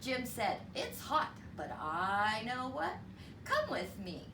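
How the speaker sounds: usual speaking voice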